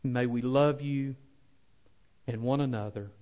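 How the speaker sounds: unhurried, earnest pulpit prayer; closing prayer. Acted. Pastor praying for his church at the close, measured and earnest. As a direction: pray it sincerely over the congregation.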